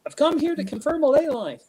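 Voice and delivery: high-pitched imitation of a Kiwi; New Zealand accent. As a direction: playfully mimic a New Zealand shaman. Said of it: "you're from New Zealand".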